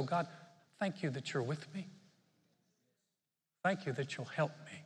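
quietly trust, thank him gently; grateful prayer. Shown as a demonstration. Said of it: "one of the best things we can do".

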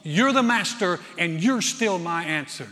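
loud, high preaching of her faith; powerful declaration. Retold or imitated. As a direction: proclaim her trust with force. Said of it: "she says something powerful".

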